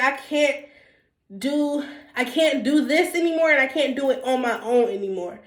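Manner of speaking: ordinary delivery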